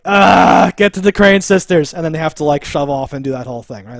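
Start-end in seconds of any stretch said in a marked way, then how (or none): 0.1-1.9 mock shout. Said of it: "he's like".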